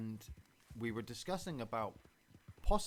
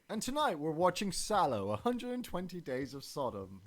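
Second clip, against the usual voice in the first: upbeat show-host intro, higher and louder; YouTube host; 6 semitones higher, 7 dB louder than usual. Pretend. Mock YouTuber introducing tonight's film, hyped host voice. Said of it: "imagine if we were, like, on YouTube".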